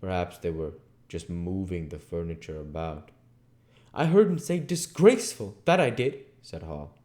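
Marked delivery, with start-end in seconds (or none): 3.9-6.2 insistent rustic landlord. Acted. Landlord insisting to his wife.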